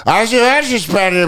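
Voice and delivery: high-pitched imitation of unintelligible Dutch; mock foreign babble. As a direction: garble it like a stranger's foreign reply. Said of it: "whatever the fuck she said".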